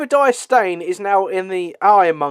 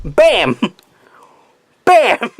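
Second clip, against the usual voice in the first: comic impact cries, the second jumping higher; silly put-on voice; 4 semitones higher, 4 dB louder than usual. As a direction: punch out each hit like a cartoon sound effect. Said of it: "every character's got the same voice".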